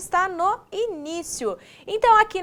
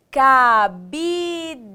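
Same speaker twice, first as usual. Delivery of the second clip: CABIDE slow, each syllable held; 3 dB louder than usual. Shown as a demonstration. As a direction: stretch out "Cabide" in separate syllables. Teacher sounds out the word. ("Cabide" devagar, sílaba por sílaba)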